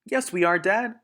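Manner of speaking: very high, loud little-fox cry; small foxes shouting to their father; eager child fox. Acted. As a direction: insist you can do it. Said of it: "cried the small foxes".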